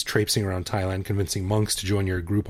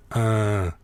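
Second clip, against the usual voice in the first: imitating his wife. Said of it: "she goes".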